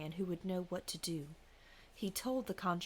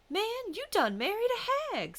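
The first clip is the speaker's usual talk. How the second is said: much higher, loud startled cry; conjure man exclaiming; 8 dB louder than usual. A narrator's reading. Conjure man telling the husband his wife is a hag, loud high cry.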